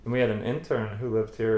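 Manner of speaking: ordinary talk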